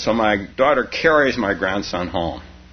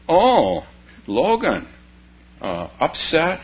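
mock-tender grandma cooing at the child; grandma's voice; 8 semitones higher, slower than usual. Grandma on Skype asking the crying toddler, higher coo.

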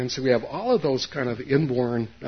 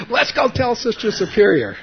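put-on much higher and louder kid voice; eager kids calling; 10 semitones higher, 8 dB louder than usual. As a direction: shout it like excited friends. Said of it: "my friends grabbed me and said".

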